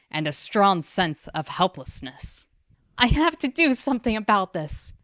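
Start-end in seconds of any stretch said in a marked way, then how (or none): 3.0-4.9 put-on higher, thought-voice of a distressed girl; inner thought. A narrator's reading. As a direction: voice her silent resolve, tense. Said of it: "she thought".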